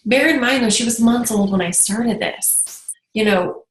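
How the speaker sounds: usual speaking voice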